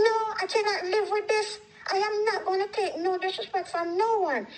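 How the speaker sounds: high, heated retold refusal; indignant and insistent; adamant refusal.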